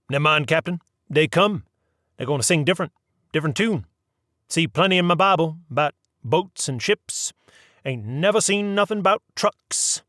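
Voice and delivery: a deckhand comforting his captain; gruff old engineer. A narrator's reading. Chief engineer consoles captain.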